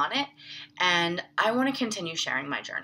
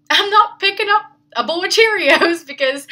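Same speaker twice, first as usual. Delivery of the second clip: laughing through the confession, voice jumping much higher and louder; embarrassed giggle breaking into the words; 12 semitones higher, 12 dB louder than usual. Real, not acted. Admitting her Cheerios binge to listeners, laughing.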